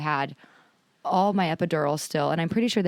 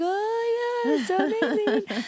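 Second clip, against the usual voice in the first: very high, gushing joy; a hammed-up high squeal of delight; slower than usual. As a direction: gush like overjoyed new parents. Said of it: "We're like".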